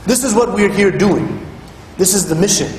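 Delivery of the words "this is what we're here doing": normal talking voice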